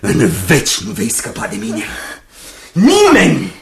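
rising to a high, loud outburst; possessive threat. Acted. Jasper threatening Rose that nobody will part them. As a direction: fling the threat at her, full force.

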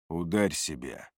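low, soft order; quiet command. A narrator's reading. Narrator forces Бардак to hit himself, quiet command.

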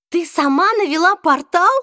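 high, loud thrilled question; excited. A narrator's reading. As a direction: blurt it out with excitement. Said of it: "возбуждённо переспросила Изабель".

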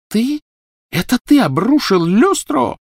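high, loud incredulous demand; shocked outrage. A narrator's reading. Mistress confronting the elf about the chandelier, shocked. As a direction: shriek the accusing question at a former servant.

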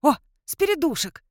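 high, joyful exclamation; delighted. A narrator's reading. Werewolf overjoyed to see a spirit, high delight. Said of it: "обрадовался Дракулешти".

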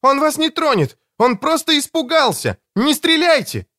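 very high, urgent plea; girl's high-pitched, pleading cry; desperate cry. A narrator's reading. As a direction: cry out high to stop them. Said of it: "схватила собаку за ошейник и крикнула".

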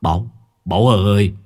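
put-on drunk friend yelling, fast at the end; slurred drunken shout. A narrator's reading. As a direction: bawl it, tipsy and urgent. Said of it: "Một giọng nói lè nhè". (giọng say lè nhè gào lên)

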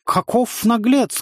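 put-on high, drawn-out Feidland accent; shouted Feidland accent. A narrator's reading. Rider rebuking insolent villager, imitated Feidland accent. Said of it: "крикнул ему Вульф, старательно имитируя акцент фейдландцев".

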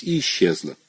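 ordinary delivery, nothing marked

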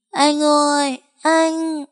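put-on high, tearful little girl's call; small girl's voice cracking from crying; choked child's cry. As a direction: call her brother through a choked sob. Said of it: "tiếng con bé nghẹn lạc cả đi".

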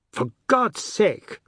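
high, loud, slow exasperation; drawn-out exasperated appeal; calling out. A narrator's reading. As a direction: call it out in exasperation. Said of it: "Oad called".

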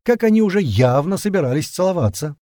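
loud, high playful teasing; cheerful teasing. Acted. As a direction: tease them cheerfully, loudly. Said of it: "весело прокричал Леднев".

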